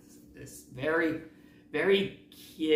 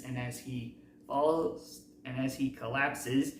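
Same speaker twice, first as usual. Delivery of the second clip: ordinary talk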